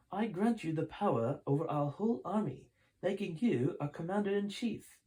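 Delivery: colonist voice. Acted. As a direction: colonist granting power, high and formal. Colonist crowns Sheldon.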